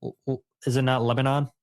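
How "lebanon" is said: Lebanon with a full "non" at the end. Shown as a demonstration. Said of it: "I've always just heard it as Lebanon".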